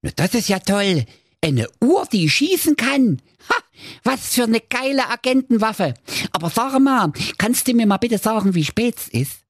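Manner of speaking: high squeaky gush turning teasing; excited dwarf. Acted. Dwarf Gernot admires the watch gun, squeaky and excited. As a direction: sound thrilled, then set up the joke. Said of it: "war ganz begeistert und rief".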